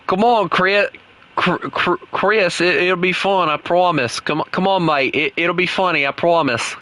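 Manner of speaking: pleading in a mock Aussie accent; Australian accent. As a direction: beg and badger in put-on Australian. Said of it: "keep on talking in this, uh, this accent".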